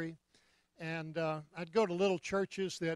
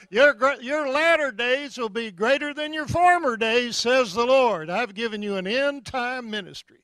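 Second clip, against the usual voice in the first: loud, raised mock prophecy in Arkansas accent; 5 semitones higher, 8 dB louder than usual. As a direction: declaim it as a hammed-up Arkansas prophet. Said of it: "I'd do this in an Arkansas accident".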